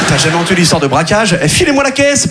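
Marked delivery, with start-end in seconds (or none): 1.4-2.3 robber voice. Pretend.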